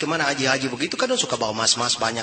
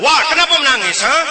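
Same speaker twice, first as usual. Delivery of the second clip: much higher, louder shouting uncle; mock shouted question; 12 semitones higher, 8 dB louder than usual. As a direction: bellow at the crying child. Uncle yelling at crying kid.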